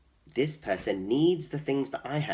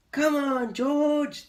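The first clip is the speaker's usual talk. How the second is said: much higher, louder animal chorus voice; animals' voices; 14 semitones higher, 6 dB louder, much slower than usual. Acted. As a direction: call out eagerly as the animals. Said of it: "they said".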